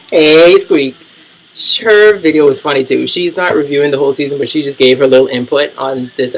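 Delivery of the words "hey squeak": loud shout-out greeting; calling out. Real, not acted. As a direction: call out to a fellow YouTuber. Calling out to fellow YouTuber.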